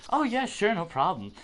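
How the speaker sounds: high, loud, chipper put-on voice; mock substitute. Imitating the substitute happily handing over the item. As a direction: play the clueless substitute eagerly.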